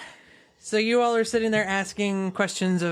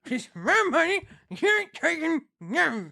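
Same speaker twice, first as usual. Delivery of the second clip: higher, slow stubborn bandit; higher petulant bandit; Bandana Herbert's voice; 5 semitones higher, slower than usual. Acted. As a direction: whine it like a thief clutching his loot. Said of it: "Bandana Herbert voice again".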